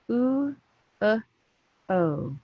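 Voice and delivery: "Oo" high, then "uh" short, then "o" falling; phonics sounds. Shown as a demonstration. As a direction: sound out "Oo", "uh", "o" one by one with pauses. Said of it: "Three sounds".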